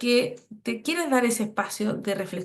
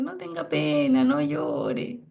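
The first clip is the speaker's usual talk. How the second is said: put-on soothing a crying child; slower than usual. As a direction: hush a little one who is crying. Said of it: "uno le dice".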